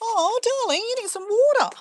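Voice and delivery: high sweet voice to the chicken; pet talk. Real, not acted. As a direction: coo at the pet lovingly. Talking to thirsty chicken.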